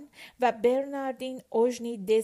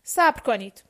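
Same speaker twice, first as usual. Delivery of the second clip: loud, displeased order; grudging command at raised volume; 8 dB louder than usual. A narrator's reading. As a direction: tell them to wait, clearly dissatisfied. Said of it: "با لحنی ناراضی گفت".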